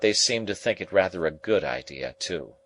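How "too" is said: ordinary delivery, nothing marked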